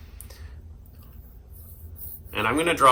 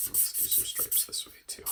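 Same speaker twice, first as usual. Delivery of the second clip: whisper; 12 dB quieter than usual. Real, not acted. Whispering to self while coloring.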